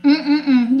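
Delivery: ordinary talk, nothing marked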